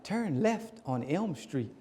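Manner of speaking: slow, soft navigation voice; GPS lady. Retold or imitated. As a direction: imitate a little lady giving directions. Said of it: "little lady's voice comes on".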